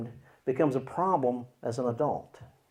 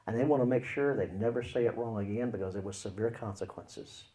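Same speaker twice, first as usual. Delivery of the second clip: ordinary delivery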